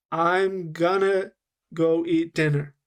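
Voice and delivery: drawn-out "I'm gonna", then "go eat dinner"; slow-motion demo of the linked phrase. Shown as a demonstration. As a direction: stretch "gonna" slowly so learners hear it. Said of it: "let me slow down what I did".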